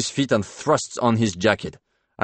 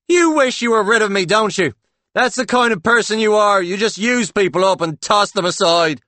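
shrill, high, loud jealous accusation; hammed-up jealous lover; 13 semitones higher, 9 dB louder than usual. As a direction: overplay the jealous partner. Paul's staged quarrel.

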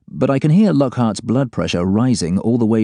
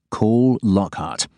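ordinary delivery, nothing marked

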